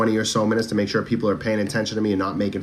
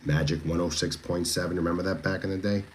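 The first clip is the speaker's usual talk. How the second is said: bedtime magic voice; 3 semitones lower, 6 dB quieter than usual. Shown as a demonstration. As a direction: lower and much softer, smooth radio DJ. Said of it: "this is my bedtime magic voice".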